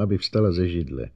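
ordinary delivery, nothing marked

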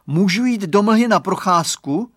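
put-on loud, high boy's question; voiced child begging permission; eager little boy. A narrator's reading. Boy asks mother for permission.

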